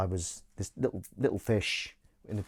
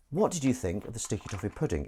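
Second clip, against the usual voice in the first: usual speaking voice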